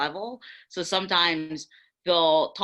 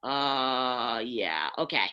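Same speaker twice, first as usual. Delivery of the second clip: sheepish concession; 5 semitones lower, much slower than usual. Retold or imitated. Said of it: "they sit there and they go".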